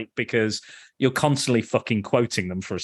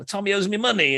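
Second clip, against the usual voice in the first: higher whiny voice of the bar owner; bar guy complaining; 9 semitones higher than usual. Retold or imitated. Retelling Goodfellas scene, bar owner whines higher. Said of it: "the guy from the bar is like".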